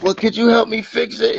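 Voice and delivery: high, sugary ask, hammed-up; suddenly sweet as a favour-seeker; sweet mock plea. Comedian mimicking a woman changing her tune, high sugary mock voice. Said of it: "and then she like".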